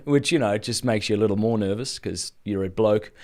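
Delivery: usual speaking voice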